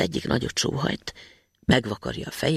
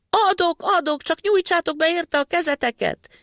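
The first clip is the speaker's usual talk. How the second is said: shouting. A narrator's reading. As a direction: yell it to trap them. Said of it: "kiabálta".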